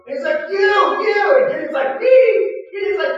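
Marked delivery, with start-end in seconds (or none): 0.5-1.4 higher, louder insisting; insistent shout. Retold or imitated. God insisting to Gideon, insistent shout. 2.0-2.6 much higher, louder startled Me; astonished squeak. Retold or imitated. Gideon stunned God picked him, astonished squeak.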